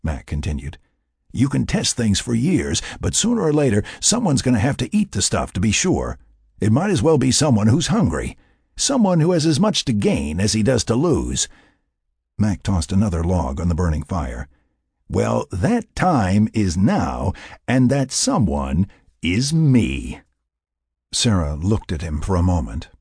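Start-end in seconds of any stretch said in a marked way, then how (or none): 1.3-11.5 higher-pitched, impassioned argument; insistent, exasperated case-making; passionate Mac. Acted. 15.1-20.2 very slow, higher, emphatic; deliberate, weighty announcement; resolute declaration. Acted. Mac declares his resolve.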